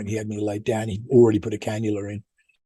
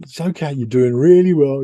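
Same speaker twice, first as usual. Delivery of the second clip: patronizing; 3 semitones higher, 6 dB louder than usual. Retold or imitated. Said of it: "this patronizing".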